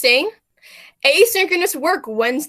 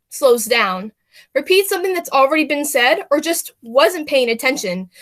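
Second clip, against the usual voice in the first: ordinary talk, nothing marked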